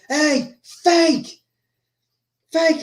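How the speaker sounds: high-pitched, drawn-out mock call; retold shout. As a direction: call out a rival across the arena, without full force. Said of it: "shouts out to Johnny Cage".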